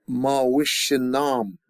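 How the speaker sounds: slow "ma wishin nam" with a short "ma", not "mao". Shown as a demonstration. Teacher corrects the class, short "ma" not "mao". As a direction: clip "ma" short and keep the rest slow. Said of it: "not mao, ma".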